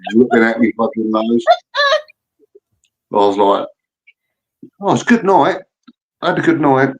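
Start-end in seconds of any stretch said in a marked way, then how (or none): 4.8-6.9 sarcastic. Retold or imitated. Retold ironic self-remark.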